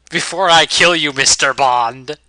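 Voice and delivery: loud, slow Bond-villain gloat; movie villain. Pretend. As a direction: ham up the smug villain line. Mocking villain monologue cliché.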